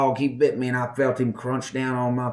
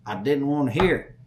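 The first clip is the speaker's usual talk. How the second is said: ordinary talk, nothing marked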